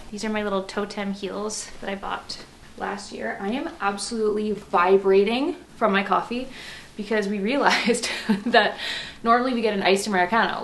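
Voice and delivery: usual speaking voice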